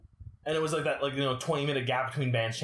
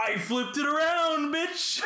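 gloating shout; 12 semitones higher, slower than usual. Real, not acted. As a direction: crow it over your friend. Gloating at co-host.

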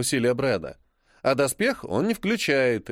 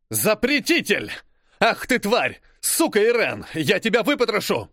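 shrieking Englishman; 7 semitones higher than usual. Acted. British spy raging at the journalist, mild shriek. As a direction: scream threats at the woman like a hysterical Englishman. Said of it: "визгливый мужской голос с сильным британским акцентом".

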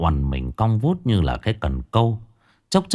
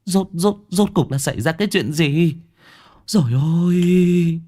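aghast, startled; 9 semitones higher than usual. Acted. Mother sees bruises on her daughter, aghast. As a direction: stammer in shock, then wail. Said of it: "Bà thẳng thốt".

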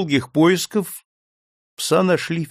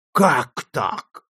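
flaring up. Acted. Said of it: "вспыхнул хозяин".

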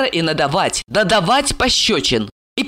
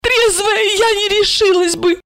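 put-on drunk frightened woman. A narrator's reading. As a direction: plead fear in a high, slowing voice. Drunk woman confesses fear.

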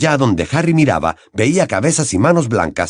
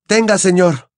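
shout; 9 semitones higher than usual. Acted. Harry rushing water to Dumbledore, shouting. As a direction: call out as he lunges. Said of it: "gritó".

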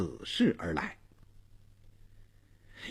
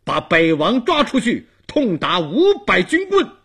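furious command rising higher; loud angry shout; 6 semitones higher, 6 dB louder than usual. A narrator's reading. Dong Wang orders Bei Wang dragged out and beaten, shouting angrily. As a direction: bellow the order, climbing to the end. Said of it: "他高声怒喝".

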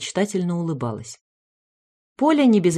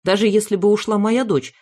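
ordinary delivery

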